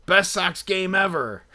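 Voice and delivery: high, slow punchline; stretched-out mock triumph; elated proclamation. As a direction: imitate a friend proclaiming it, drawn out. Friend's triumphant verdict, punchline.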